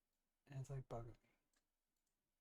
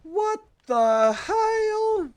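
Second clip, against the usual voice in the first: high, loud, very slow outraged Uncle Roger cry; 15 dB louder, much slower than usual. Retold or imitated. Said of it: "As Uncle, Uncle Roger would have said".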